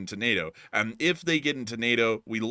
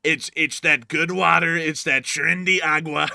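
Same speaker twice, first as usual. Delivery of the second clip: slow put-on impression voice; Trinderagua voice; 4 semitones higher, 6 dB louder than usual. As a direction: drawl it out in the impression. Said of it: "that Trinderagua voice".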